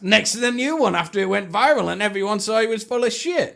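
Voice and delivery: usual speaking voice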